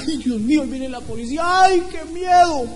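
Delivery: hammed-up frightened wail, rising high at the end; mock fear. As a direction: imitate someone scared, stretching the last cry. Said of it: "Por eso tal vez tiene miedo".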